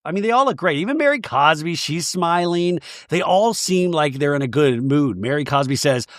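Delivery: ordinary delivery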